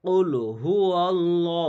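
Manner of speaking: slow chanted কুল হুয়াল্লাহু with heavy ল্লা; Quran recitation. Shown as a demonstration. Teacher showing students the recited verse slowly. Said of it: "তো কীভাবে পড়বেন?".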